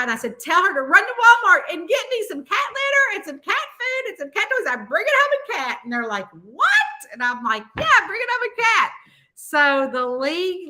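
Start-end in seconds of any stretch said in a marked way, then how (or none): 0.4-5.8 high-pitched rush of orders; retold giddy announcement; excited instructions. Urgent errand by phone, retold. 6.3-7.1 high, loud incredulous cry; retold yelp of astonishment; shocked disbelief. 7.8-8.9 high, quick confirmation; retold giddy announcement; excited insistence.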